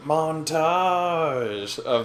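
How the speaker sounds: drawn-out, rising showy flourish; playful hammed-up announcer swoop; theatrical announcement. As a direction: stretch the word out like a grand cue.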